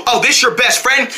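ordinary talk, nothing marked

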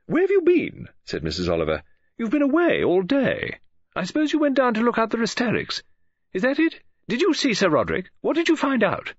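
high, rapid-fire demands on the phone; a nosy lady novelist pressing for news, pitched high; Mrs Oliver's eager questioning. A narrator's reading. Mrs Oliver pressing Poirot on the phone, high and eager.

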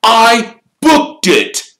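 slow, loud, high insistence; furious, drawn-out protest; spitting with rage. A narrator's reading. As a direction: hammer each word out in rage. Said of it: "positively spitting with rage".

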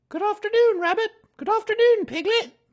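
sing-song motherly greeting. A narrator's reading. Kanga greets Pooh, very high and motherly.

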